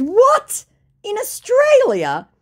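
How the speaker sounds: high, loud, drawn-out disbelief; incredulous. Retold or imitated. Woman replying to a scammer's message, incredulous. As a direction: stretch it out in mock shock.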